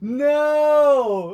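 loud, very high "no" cry; mock anguished wail. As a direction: wail in hammed-up horror. Mock despair over broken egg.

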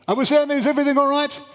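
high, fast shouted check from below; retold anxious call from the street. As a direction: holler up to the roof. Truck driver calling up to roof.

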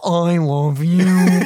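slow drawn out, far voice; mock love. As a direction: croon it to a cartoon. Addressing Barney Phil thumbnail.

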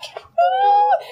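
very high comic wail; hammed-up tearful squeal; mock despairing cry. Lost new starter looking around at people, mock despairing cry.

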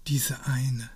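ordinary delivery, nothing marked

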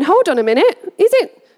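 mock bewildered pet waking up; confused hamster. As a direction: play a baffled little hamster. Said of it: "She's, like, waking up going".

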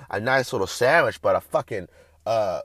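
ordinary delivery

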